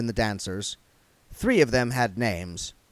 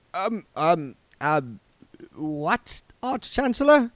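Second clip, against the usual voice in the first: nervous; 6 semitones higher, slower than usual. Acted. Nervous servant, close to panic.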